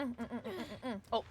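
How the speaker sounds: ordinary talk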